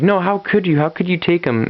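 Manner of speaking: high, pleading cry of disbelief; a mother's high, anguished protest; distraught wife. Retold or imitated. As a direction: cry out at the husband as the son is taken. Wife as son is taken.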